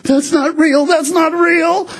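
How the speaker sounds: put-on a kid's high, insisting "not real", the second time slow; high, frightened child's voice, slowing down; imitating a scared little boy's denial. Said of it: "Brian's like".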